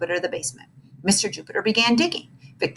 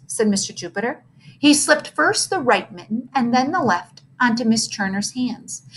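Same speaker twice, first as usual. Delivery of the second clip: plain reading voice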